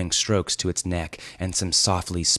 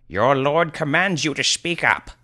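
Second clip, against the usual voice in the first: raised, lordly order; commanding sorcerer; 9 semitones higher, 4 dB louder than usual. Acted. Sorcerer ordering the hesitant youth, commanding. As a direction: issue it as a grand command. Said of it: "Grand Alf stated unnecessarily".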